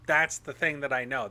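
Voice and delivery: usual speaking voice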